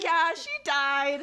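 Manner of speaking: slow, laughing "she died"; mock deadpan through laughter. Telling the roommate her friend died, laughing. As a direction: drag it out, half laughing. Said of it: "I was like".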